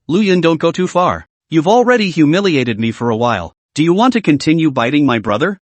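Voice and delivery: plain reading voice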